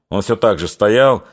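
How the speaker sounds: ordinary delivery, nothing marked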